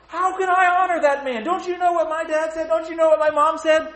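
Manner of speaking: high, loud whiny protest; loud, high-pitched kid objecting to God; protesting child. Acted. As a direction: whine like a hurt teenager. Child protesting about bad parents.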